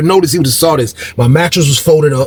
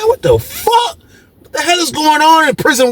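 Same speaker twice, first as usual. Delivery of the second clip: disbelieving cry; 13 semitones higher than usual. Real, not acted. Disbelief at the prison chaos.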